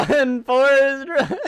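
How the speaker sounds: hammed-up movie shout. Streamer repeating a movie line as a joke, laughing mock shout. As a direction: call it out while cracking up.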